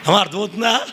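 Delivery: ordinary delivery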